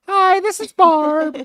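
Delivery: very high, loud, slow caller; exaggerated sing-song lady on the phone; Barb. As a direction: belt out a falsetto phone greeting. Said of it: "Yeah, Barb".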